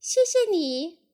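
high, loud little tadpole thanks. A narrator's reading. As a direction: pipe up politely as a baby tadpole. Tadpoles thank mother duck. (小蝌蚪高声道谢)

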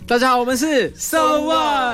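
loud, high, drawn-out band greeting; band greeting shout. Acted. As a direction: belt out the band's name. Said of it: "cue到最后一趴". (高声拖长的乐团招呼)

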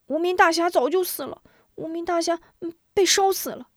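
put-on high, fast chattering kids' voices; a crowd of kids all blurting at once; clamoring children. A narrator's reading. Children clamoring to the two elders that the hero died. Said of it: "群童七嘴八舌乱嚷嚷".

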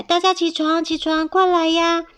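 high, urgent wake-up call. A narrator's reading. Mouse waking everyone up, excited calling out. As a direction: rouse the whole house. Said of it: "赶快叫大家起来".

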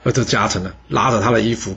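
ordinary delivery

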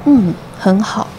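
imitating an old doctor's unhurried verdict; slow, deliberate quoted reply; slow-talking old doctor. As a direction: draw it out slowly. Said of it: "讲话很慢".

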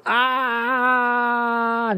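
突然拔高的一声惊叫; 像被吓到一样猛叫一声; 惊吓尖叫. Acted. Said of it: "吓了一大跳，他说". (吓了一大跳的忍者龟高声尖叫)

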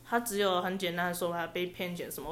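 ordinary delivery

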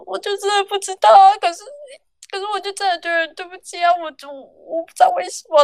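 high, breaking, tearful telling-off reply; tearful plea. Retold or imitated. Telling the shift lead she is sorry, voice high and tearful. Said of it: "然后我就开始大哭".

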